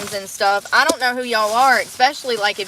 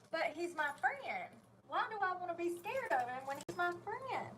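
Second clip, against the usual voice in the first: tiny, high, hushed voice; small high-pitched pleading; meek protest; 7 semitones higher, 21 dB quieter than usual. Acted. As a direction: plead very softly, pitched up like a hurt child. Acts out own protest to sermon.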